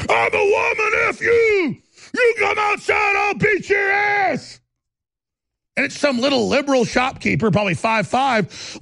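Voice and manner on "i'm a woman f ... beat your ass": high, shouted mock threat; mock tough guy. Mocking the man in the video threatening the clerk, shouted. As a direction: ham up a brawler yelling at a clerk. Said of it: "dude with a pink shirt going".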